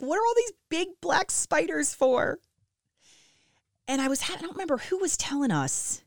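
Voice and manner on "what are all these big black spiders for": much higher, incredulous question; baffled. Retold or imitated. Mom complaining about basement spiders, high incredulous question. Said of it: "I'm like".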